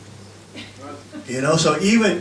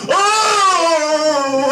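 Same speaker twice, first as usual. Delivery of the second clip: high laughing mock yell; mock passenger yell; 9 semitones higher than usual. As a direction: cry out like a frightened passenger. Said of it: "They all go".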